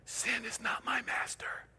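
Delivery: slow, voiceless whisper. Shown as a demonstration. Preacher leading the congregation to repeat after him, whispering. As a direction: whisper it slowly, almost no sound. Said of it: "Now whisper it with me".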